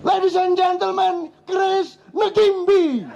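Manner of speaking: high, grand announcer voice, "Negimbi" with an added e; mocking announcer. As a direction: ham up a world sports announcer who mangles "Negimbi". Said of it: "penyiarnya seluruh dunia". (meniru penyiar TV yang salah menyebut "Negimbi")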